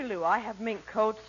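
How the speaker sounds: ordinary talk, nothing marked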